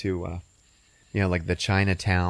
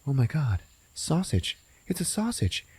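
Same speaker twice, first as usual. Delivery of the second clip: excited discovery; 7 semitones higher than usual. Retold or imitated. Barry spotting sausage.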